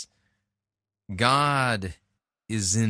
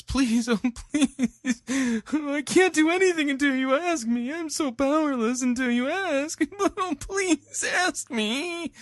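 very high, whiny falsetto plea with laughs; mocking God begging. Critic parodying the preacher's begging God. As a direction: ridicule the idea of a helpless God. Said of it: "God's begging us".

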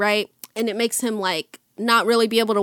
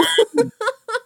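high laugh; 11 semitones higher than usual. Real, not acted. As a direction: burst out laughing. Laughs after joke.

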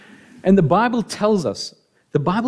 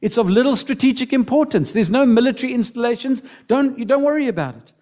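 higher, dismissive reassurance; family reassuring; 7 semitones higher than usual. Retold or imitated. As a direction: brush off her fears lightly. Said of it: "They say".

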